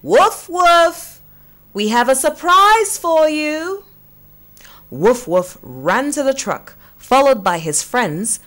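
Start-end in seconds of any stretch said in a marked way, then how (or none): 0.0-3.8 high, louder call across the farm. Acted. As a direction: call the dog from the truck, high and loud. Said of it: "Mister and Missus Talk Talk called".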